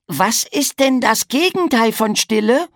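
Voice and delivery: put-on high, childlike questioning voice; piping and eager; curious little brother. Piggledy asks his big brother the opposite of silence, high and curious.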